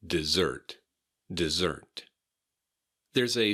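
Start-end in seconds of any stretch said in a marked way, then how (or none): 0.1-2.0 Dessert stressed on the second syllable, di-ZERT; Dessert with a weak first syllable, strong second. Shown as a demonstration. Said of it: "the emphasis is on the second syllable".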